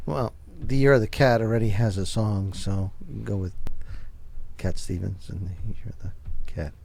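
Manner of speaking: low, soft mumble that fades out; mumbling. Real, not acted. Musing to co-hosts about a cat song, mumbling. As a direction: trail off under your breath, hard to hear. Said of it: "Why are you mumbling?".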